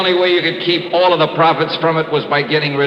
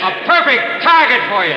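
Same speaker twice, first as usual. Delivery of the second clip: sneering taunt; 9 semitones higher, 4 dB louder than usual. Acted. Detective confronting killer.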